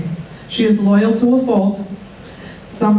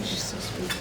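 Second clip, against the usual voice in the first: unvoiced side remark, much softer; whispered aside; 17 dB quieter than usual. Real, not acted. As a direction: whisper it away from the mic. Whispered aside about a helper.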